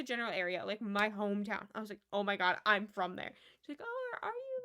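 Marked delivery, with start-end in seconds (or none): 2.1-3.3 loud, falling burst of amazement; loud retold disbelief; excited exclamation. Meeting someone from hometown. 3.8-4.6 much higher, stammering surprise; high-pitched imitated astonishment; high surprised. Customer voiced in story.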